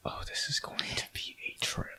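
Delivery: breathy whispered comment; whispered aside. Real, not acted. Player whispering an aside before the big item. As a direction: whisper it to the table.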